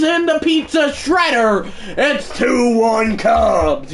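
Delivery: very high, loud, drawn-out home run call; shouted sportscaster call; play-by-play call. Acted. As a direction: call it like the big homer on TV.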